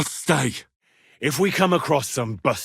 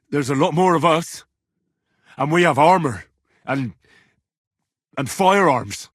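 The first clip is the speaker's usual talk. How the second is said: forceful warning; 4 semitones higher, 5 dB louder than usual. Acted. Threatening boast of force.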